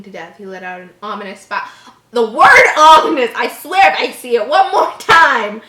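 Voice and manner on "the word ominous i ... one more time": loud, high fed-up rant; exasperated rant. Real, not acted. Reader ranting at the word ominous in the story. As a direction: vent at the overused word.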